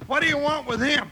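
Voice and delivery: ordinary talk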